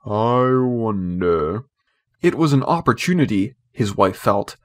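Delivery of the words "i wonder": slow, musing wonder; drawn out, lost in thought; thoughtful. A narrator's reading. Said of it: "Mister Darling said thoughtfully".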